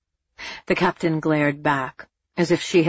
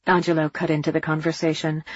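plain reading voice

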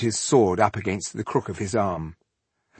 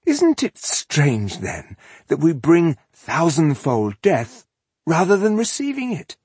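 raised, slow, lilting; amused villain musing; 7 semitones higher, 4 dB louder than usual. A narrator's reading. Elf answering the rebel's curse, mock puzzled. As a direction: muse aloud with mock puzzlement.

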